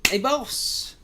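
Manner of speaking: very high, badly done character voice. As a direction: squeal out a terrible character impression. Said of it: "terrible pink guy impersonation".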